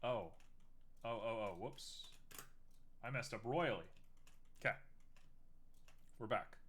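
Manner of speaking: ordinary talk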